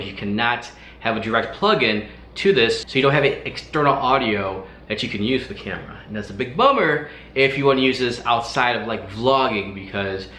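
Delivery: ordinary delivery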